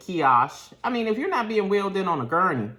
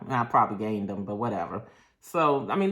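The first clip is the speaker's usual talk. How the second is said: ordinary talk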